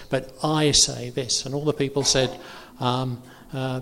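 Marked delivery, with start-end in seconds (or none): 0.1-1.4 slow, weighty "I" in "but I say this"; emphasis on I. Retold or imitated. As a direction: set "I" against the rest, drawn out. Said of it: "when he's talking like that".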